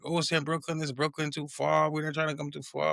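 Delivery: high, whiny lazy excuse voice; mocking friends' excuse. Mimicking friends' excuse about Brooklyn, high and whiny. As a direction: parody their excuses. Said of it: "y'all excuse was like".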